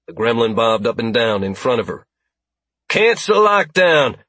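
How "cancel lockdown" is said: loud, slow order; booming command. Acted. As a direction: boom it through the chamber. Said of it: "her words boomed through the chamber".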